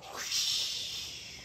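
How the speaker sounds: breathy whoosh of wind. Acted. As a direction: blow it like wind. Wind sound effect.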